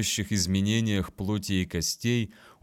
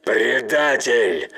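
louder, spat-out accusation; 7 dB louder, much slower than usual. Acted. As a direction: spit the word in disgust. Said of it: "выплюнул Гаро".

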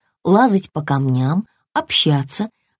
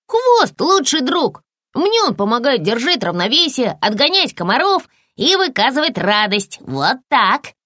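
higher-pitched, lightly acted proud puppy; lightly voiced puppy; 6 semitones higher than usual. Puppy tells lizard why tails matter, lightly voiced, higher. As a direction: lightly play a puppy praising his tail.

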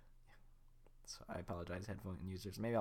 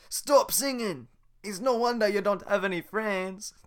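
Shrek yelling; 10 semitones higher, 12 dB louder than usual. Acted.

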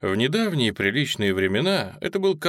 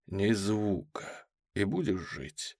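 very quiet, slow menace; menacing low threat; 4 semitones lower, 7 dB quieter, slower than usual. Acted. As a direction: threaten softly and slowly. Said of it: "внушительно сказал мужчина".

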